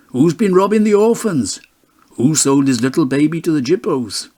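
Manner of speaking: jeering voice. A narrator's reading. Pub men jeering at Bob.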